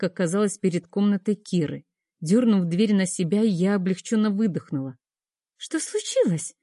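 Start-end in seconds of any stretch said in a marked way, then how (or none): 5.6-6.5 high, startled question; a scared girl asking what happened; frightened question. A narrator's reading. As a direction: ask in sudden fright. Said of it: "испуганно спросила Милана".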